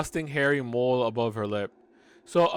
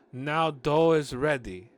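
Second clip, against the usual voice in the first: put-on Russian accent; 2 semitones higher, 5 dB louder, slower than usual. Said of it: "thick Russian accent".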